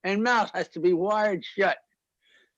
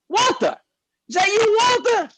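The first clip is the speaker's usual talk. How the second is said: calling out; 12 semitones higher than usual. Acted. Calling old friend across supermarket.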